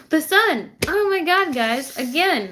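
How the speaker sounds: real excited burst, high and loud. Real, not acted. Tarot reader thrilled by the card she drew, excited. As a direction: laugh into a thrilled, high exclamation. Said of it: "sorry for being excited".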